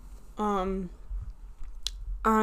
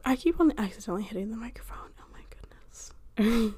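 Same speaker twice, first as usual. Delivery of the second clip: whispered aside; 4 dB quieter than usual. Real, not acted. Aside about bumping the microphone.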